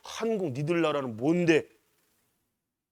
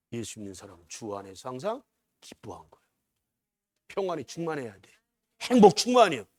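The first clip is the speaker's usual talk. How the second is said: ordinary talk, nothing marked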